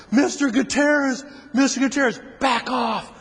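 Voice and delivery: a cop running up, calling to back off; urgent cop call. Retold or imitated. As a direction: rush in and call him off. Second cop runs in calling.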